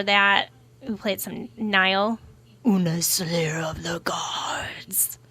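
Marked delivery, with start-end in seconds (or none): 2.7-5.2 a hint of a slow, whispered metal growl. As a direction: lightly growl it like a death metal singer. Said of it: "That's my best metal voice that I can do".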